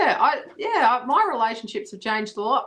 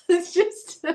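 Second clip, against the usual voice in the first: laughing; 9 semitones higher than usual. Real, not acted. Laughing about the yawn.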